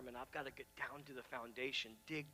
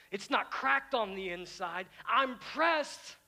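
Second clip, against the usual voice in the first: loud, high defiant declaration; I'm pressed ringing out; triumphant pulpit proclamation; preaching voice; 5 semitones higher, 9 dB louder, slower than usual. Acted. Preaching at full force.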